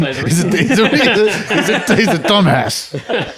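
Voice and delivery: high, slow laughing tease. Real, not acted. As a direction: laugh the words out slowly.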